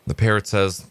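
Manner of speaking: ordinary talk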